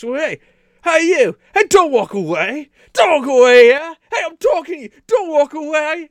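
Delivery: loud, high-pitched English hooligan yelling; hammed-up belligerent hooligan shout; English soccer hooligan. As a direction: holler after someone walking off, English yob style. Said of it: "there's an English soccer hooligan".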